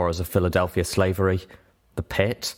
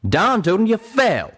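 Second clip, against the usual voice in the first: loud, high exasperated retort; 13 semitones higher, 7 dB louder than usual. Acted. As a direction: snap back in frustration. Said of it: "Calamity retorted".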